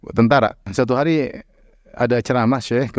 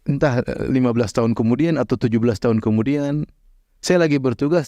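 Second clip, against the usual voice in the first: ordinary delivery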